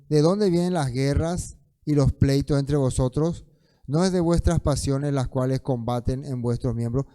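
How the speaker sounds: loud, declamatory Bible reading; scripture reading. Acted. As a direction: read the verse out forcefully to the congregation. Lee las Escrituras en voz alta. (lectura bíblica proclamada en voz fuerte)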